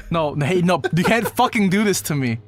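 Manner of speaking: ordinary delivery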